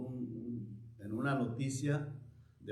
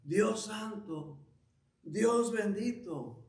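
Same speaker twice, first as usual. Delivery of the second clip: higher, drawn-out mock cry to God; slow, raised-pitch retold plea; imitated crying out; 6 semitones higher, slower than usual. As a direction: mildly act out calling on God, without real shouting. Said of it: "empezó a gritarle a Dios".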